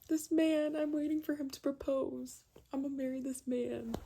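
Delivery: high-pitched gush, gradually easing down; mock swooning. Recalling her reaction to his book gift, high swooning gush. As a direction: gush it high, drawing out the adoration.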